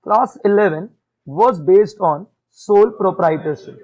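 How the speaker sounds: very slow, loud, word by word; a teacher dictating slowly and firmly; slow dictation. Shown as a demonstration. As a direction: spell it out slowly for the class. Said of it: "तो मैं बहुत धीरे बोलूंगा".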